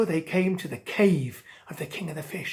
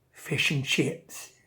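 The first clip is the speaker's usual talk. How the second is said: low, slow shark hinting at his dinner; sly shark; 6 semitones lower, 5 dB quieter, slower than usual. Acted. Shark to Angelfish, a low slow sly hint. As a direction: savour the words like a sly predator.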